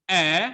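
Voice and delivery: "e" as an open "eh" vowel. Shown as a demonstration. Teacher modelling the vowel, open sound on "e". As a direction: say a wide open "e". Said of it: "can either be open E".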